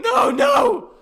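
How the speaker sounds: very high, loud screamed protest; shrieking objection; screaming. Real, not acted. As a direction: scream to stop the story. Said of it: "I'm gonna scream again".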